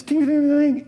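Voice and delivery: high, ringing "ting" of coins; imitated coin jingle. Preacher imitating dropped change on a New York street, ringing. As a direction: mimic spilled change hitting the pavement.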